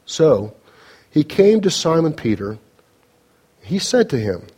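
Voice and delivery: ordinary delivery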